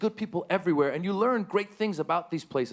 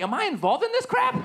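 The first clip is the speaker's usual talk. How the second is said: 1 panicked self-question; 3 dB louder than usual. Retold or imitated. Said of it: "I'm like".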